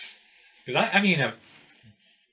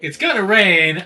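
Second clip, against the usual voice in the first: higher, louder mock forecast; terrible weatherman; 5 semitones higher, 5 dB louder, slower than usual. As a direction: announce it as a badly faked weatherman. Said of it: "I would be a terrible weatherman".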